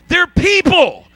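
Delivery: high-pitched, impassioned preacher shout; full-force preaching. Real, not acted. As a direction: drive every phrase home from the pulpit.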